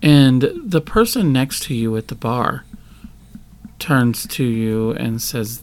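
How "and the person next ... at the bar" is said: normal talking voice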